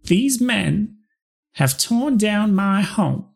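high, slow Southern belle voice; a touch of Southern belle, pitched high. Acted. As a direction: nixie grieves her home, Southern belle. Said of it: "I'll just be a Southern belle".

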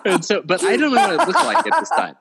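high, laughing delivery; laughing while talking. Real, not acted. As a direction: giggle through the line. Laughing mid-story.

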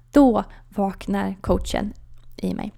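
normal talking voice

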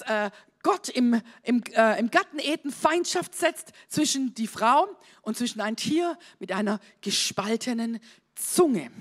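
ordinary talk

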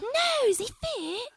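high, slow mock English insistence; English accent. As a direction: insist like an exasperated English girl. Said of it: "The accents are really heavy".

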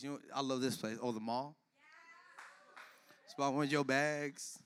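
normal talking voice

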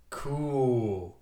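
put-on low, relaxed kid voice; laid-back cool. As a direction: say it with a grin. Impulse, smiling.

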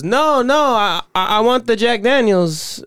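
put-on high-pitched, insistent old customer; old Mexican customer. The customer correcting the bartender, high imitated voice. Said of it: "so the guy goes".

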